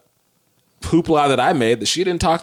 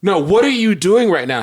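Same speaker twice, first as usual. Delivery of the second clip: retold outrage, higher and insistent; freaked-out demand; 5 semitones higher, 4 dB louder than usual. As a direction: demand it again in mock alarm. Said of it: "And I freaked out".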